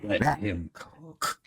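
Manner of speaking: slow, soft catchphrase; hushed, slowed-down put-on delivery; drawn-out mock reverence. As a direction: stretch each word out quietly. Acts out onlookers quoting slang phrase.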